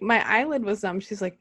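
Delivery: ordinary talk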